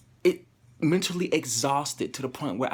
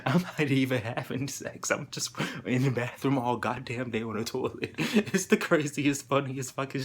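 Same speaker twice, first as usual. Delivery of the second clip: laughing; 2 semitones higher, 4 dB louder than usual. Real, not acted. Vlogger laughing at their own bathroom confession, cracking up.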